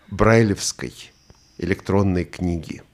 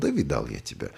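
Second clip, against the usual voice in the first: lower, softer, weary brush-off; dismissive; 7 semitones lower, 8 dB quieter than usual. Shown as a demonstration. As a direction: shrug it off, seen it all. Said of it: "вот это название мне, по крайней мере, приходит в голову".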